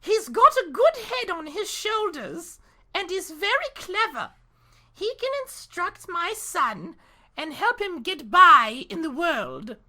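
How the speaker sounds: high put-on she-wolf voice. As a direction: pitch it way up, mock female wolf. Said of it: "she said".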